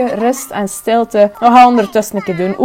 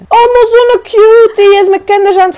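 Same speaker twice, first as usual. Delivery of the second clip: adoring squeal; cooing; 9 semitones higher, 4 dB louder than usual. Real, not acted. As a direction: melt at how cute it is. Cooing at a cute sim.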